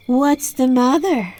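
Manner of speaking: ordinary delivery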